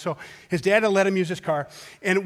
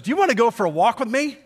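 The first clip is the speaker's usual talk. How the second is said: quick, lightly raised casual ask; wife's casual invite; 5 semitones higher, 5 dB louder, faster than usual. Retold or imitated. Wife offering a walk, light and casual. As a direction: toss it off like an easy offer. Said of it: "if she says".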